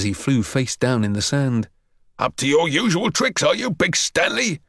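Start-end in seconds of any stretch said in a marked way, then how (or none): 2.2-4.6 high, scolding teacher's call; outraged PT master; shouting sergeant. A narrator's reading. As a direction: confront the bully with raised pitch. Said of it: "Sergeant shouted".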